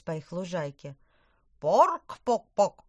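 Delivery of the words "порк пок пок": loud, slow clucking cry; hen squawk. Acted. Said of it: "крикнула Нефертити".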